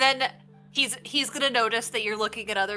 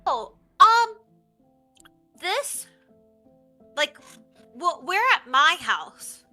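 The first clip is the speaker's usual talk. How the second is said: put-on child voice; 6 semitones higher, 8 dB louder than usual. GM voicing tiefling child NPC.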